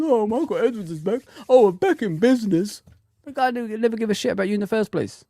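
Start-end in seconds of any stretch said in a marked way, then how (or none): 0.0-2.8 mocking fans.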